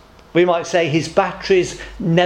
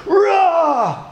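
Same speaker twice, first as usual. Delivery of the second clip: high, drawn-out roar; lion's roar; 12 semitones higher than usual. Acted. Prideful lion roars at a monkey, roaring. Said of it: "he roared at the elephant".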